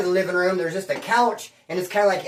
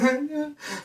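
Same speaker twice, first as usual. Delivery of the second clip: a high, hammed-up sob-laugh; mock sob; 8 semitones higher, 6 dB quieter than usual. Crying at a dog commercial, fake sob. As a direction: blubber comically. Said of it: "bro, I will cry".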